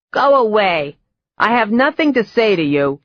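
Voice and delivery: high, loud cackle telling them to leave; cackling old woman. Acted. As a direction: shoo them off like a cranky witch. Old woman refusing visitors.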